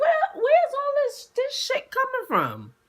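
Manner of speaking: high, slow alarmed question; startled by yelling across the street. Retold or imitated. Woman alone on a corner hears screaming, alarmed. Hearing screaming nearby at night.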